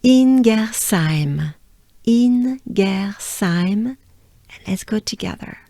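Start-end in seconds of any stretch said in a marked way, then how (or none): 0.0-3.9 slow, careful French place name; stretched, deliberate syllables in Ingersheim; pronunciation demo. Shown as a demonstration. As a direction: sound out Ingersheim slowly for learners. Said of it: "So let me do it again".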